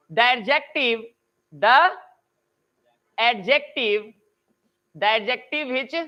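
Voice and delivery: slow dictation, each word spaced out for students to write. Acted. Teacher to online students, dictating a grammar rule slowly. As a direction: read the rule out slowly so they can copy it.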